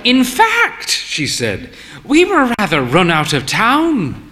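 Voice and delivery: put-on a proud old dear confessing lightly; genteel old mother. A narrator's reading. Mother telling their story.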